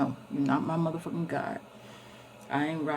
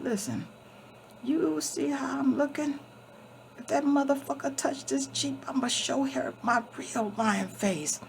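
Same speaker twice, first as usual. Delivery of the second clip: lion cat voice; 8 semitones higher than usual. Retold or imitated. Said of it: "He said".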